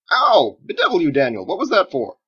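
sudden loud yelp of pain, then high indignant protest; pained outcry. Acted. Wizard hit by a thrown potato, loud pained outcry. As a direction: cry out, stung and outraged.

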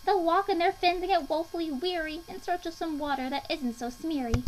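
high aggrieved creature voice, easing down at the end; Lorax's aggrieved voice. A narrator's reading. Lorax lamenting the humming fish, aggrieved. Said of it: "snapped the Lorax".

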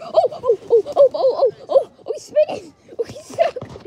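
loud, high excited oh-oh-oh with a laugh; thrilled gasps at the spinning animal. Real, not acted. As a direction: squeal with delight as it spins.

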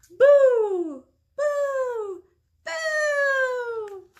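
three high, loud, held boos; triumphant boos. Acted. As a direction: boo out into the night. Jack-o'-lantern's final triumphant boos.

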